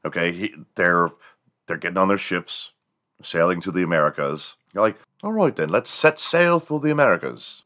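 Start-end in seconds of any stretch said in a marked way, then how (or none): 5.2-7.6 high-pitched mock English accent; hearty put-on British accent, setting off; colonist's English accent. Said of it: "Back when the colonists left for America".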